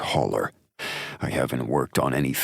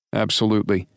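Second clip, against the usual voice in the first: higher, brisk report; buddy cop voice; 9 semitones higher, 3 dB louder, much faster than usual. Acted. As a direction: lay out the clues fast. Drake voiced.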